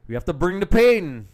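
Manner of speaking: loud, high-pitched mock rallying shout; playful battle cry. Commentator punning on the substitute Payne's name, playful battle cry. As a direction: belt it out like a hype man.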